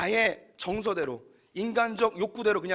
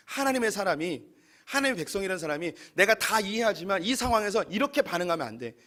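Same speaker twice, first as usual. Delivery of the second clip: ordinary delivery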